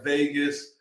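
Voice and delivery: ordinary talk, nothing marked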